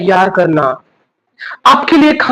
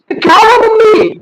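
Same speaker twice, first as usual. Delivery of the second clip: higher, whiny son voice; sleepy son; 13 semitones higher, 4 dB louder than usual. Acted. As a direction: groan it as a drowsy child. Sleepy son answers mother.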